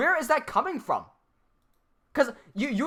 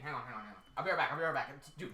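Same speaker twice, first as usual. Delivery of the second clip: ordinary delivery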